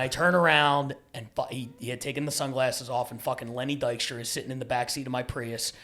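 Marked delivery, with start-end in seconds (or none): none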